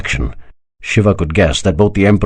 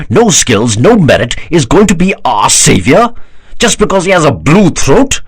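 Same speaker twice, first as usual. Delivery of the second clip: higher, louder, building scornful outrage; exasperated; 7 semitones higher, 4 dB louder than usual. A narrator's reading. Parvateshwar scorns the idea of a barbarian savior, exasperated. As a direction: spit out his disbelief, climbing. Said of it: "An exasperated Parvateshwar interjected".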